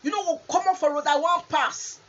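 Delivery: loud rude shout, fast and high. Retold or imitated. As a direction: yell it at a stranger blocking you. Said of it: "You go just shout".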